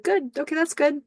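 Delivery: high, imitated tearful voice; about to cry. Billy answers Teddy on the phone, about to cry. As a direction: imitate someone trying not to cry. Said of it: "Billy's gonna cry".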